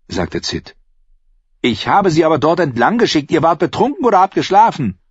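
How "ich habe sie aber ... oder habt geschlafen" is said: high, agitated retort; indignant accusation. A narrator's reading. Innkeeper blaming the lookouts, indignant. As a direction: throw the blame back at them.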